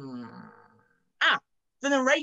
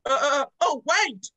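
panicked cry; 4 semitones higher, 4 dB louder than usual. Acted. Panics as TV switches on.

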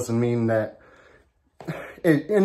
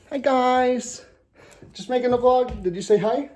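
talking to dog; 12 semitones higher than usual. Real, not acted. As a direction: coo at the little dog. Greets his dogs.

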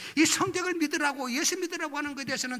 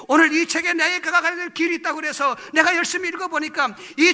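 loud, raised-pitch indignant challenge; retold angry confrontation; 4 semitones higher, 10 dB louder than usual. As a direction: confront the pastor at full volume, reliving the anger. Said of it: "하고 목사님에게 화를 냈습니다". (목사님에게 화내며 큰 소리로 따지듯)

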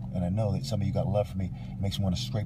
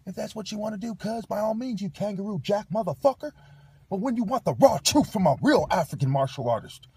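much higher, loud heated yelling; furious rant; 10 semitones higher, 7 dB louder than usual. Real, not acted. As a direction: blast them with it. Escalating rant at viewers.

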